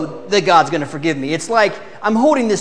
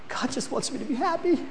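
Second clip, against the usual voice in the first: mock whiny excuse; 5 semitones higher, 12 dB quieter, faster than usual. Shown as a demonstration. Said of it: "Read it to me".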